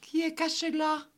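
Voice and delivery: put-on high little-girl voice; high, thin childlike question; small voice. A narrator's reading. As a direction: ask in a tiny, high child's voice. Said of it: "demanda la petite voix".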